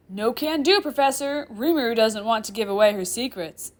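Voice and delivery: loud, playful sing-song refusal. Acted. Chair demon refusing the professor, sing-song. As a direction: lilt it teasingly, not taking them seriously. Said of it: "Sullivan says in a sing-song voice".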